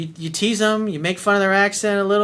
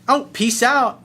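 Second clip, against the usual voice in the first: high, louder mock brush-off; dismissive woman; 8 semitones higher, 6 dB louder, slower than usual. Woman walking off, imitated in a high, louder brush-off. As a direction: toss it off like she's storming away. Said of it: "and she goes".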